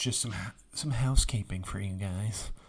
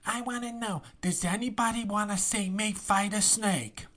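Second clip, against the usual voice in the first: much higher, mocking 1950s Brooklyn accent; mock her put-on Brooklyn voice, pitched way up; mocking old-timey Brooklyn; 11 semitones higher than usual. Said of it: "she does this very annoying kind of old-timey Brooklyn".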